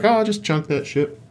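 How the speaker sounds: ordinary talk, nothing marked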